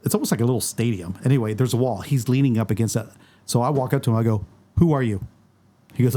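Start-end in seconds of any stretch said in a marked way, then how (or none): none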